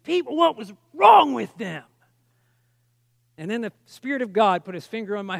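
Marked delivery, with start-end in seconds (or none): 0.0-1.8 angry. Retold or imitated. Preacher recalling his anger at those who rejected Jesus, angry. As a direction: stretch it out in exasperated anger. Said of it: "I just began to get angry".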